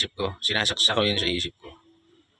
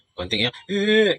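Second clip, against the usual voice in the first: high, slow mock baby fuss; mock baby whine; 10 semitones higher, 4 dB louder, slower than usual. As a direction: whine it like a hungry baby wanting milk. Baby demanding milk, fussing.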